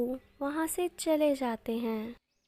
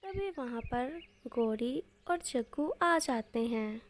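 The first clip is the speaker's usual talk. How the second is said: usual speaking voice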